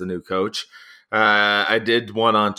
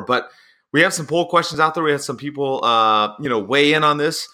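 usual speaking voice

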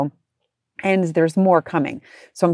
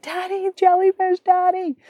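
mock child scream; 14 semitones higher than usual. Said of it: "screamed now a couple times".

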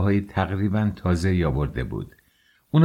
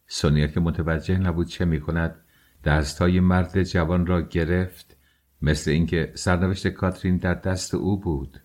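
plain reading voice

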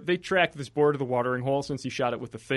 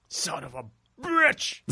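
mock curse; 3 semitones higher, slower than usual. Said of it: "it's more like".

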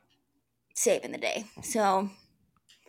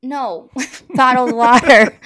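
laughing; 11 dB louder, slower than usual. Real, not acted. Laughs while correcting.

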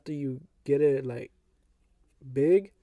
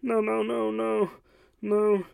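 panicked no; 6 semitones higher, slower than usual. Retold or imitated. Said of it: "He said".

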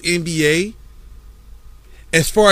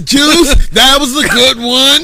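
laughing; 5 semitones higher, 5 dB louder than usual. Real, not acted. Laughing at the joke.

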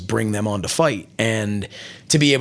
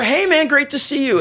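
pitched way up, bright friendly hello; mock cheery greeting. Imitating his own high, cheery hello to Nick Gage backstage. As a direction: play the overjoyed buddy greeting.

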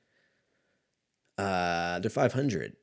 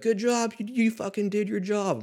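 high, goofy mock praise; high, hammed-up teammate voice; 11 semitones higher than usual. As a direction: congratulate sarcastically as the teammates. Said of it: "they're like".

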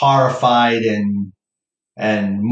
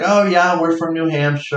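higher, eager "oh yeah" of the stranger; chirpy stranger; 7 semitones higher than usual. Retold or imitated. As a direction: imitate the couple's cheerful reply. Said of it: "they're like".

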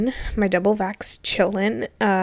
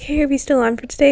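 higher, quicker perky friend; bright friend voice; 6 semitones higher, 4 dB louder, faster than usual. Retold or imitated. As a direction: chirp it like an eager friend. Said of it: "she's like".

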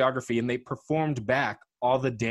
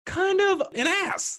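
indignant; slower than usual. Pretend. Mock indignation.

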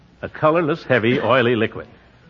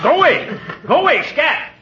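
shooing the cat. Acted. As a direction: shoo the cat off in alarm.

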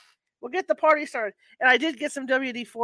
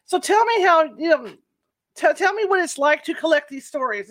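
loud; 5 semitones higher, 10 dB louder than usual. Real, not acted. Host asked to speak up.

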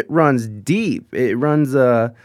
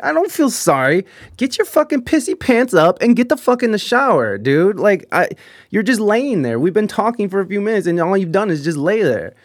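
higher, pushy tell-off; exasperated scolding; 6 semitones higher than usual. Retold or imitated. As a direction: snap at him to get up, a touch heated. Scolding Brandon to shower.

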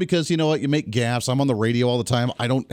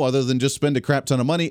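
ordinary talk

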